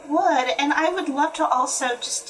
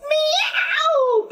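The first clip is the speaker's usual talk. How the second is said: piercingly high, long meow; high cat yowl; 4 dB louder than usual. A narrator's reading. Cat's yowl breaks the window panes, very high. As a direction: yowl it as high as you can. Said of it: "the cat yowled so high".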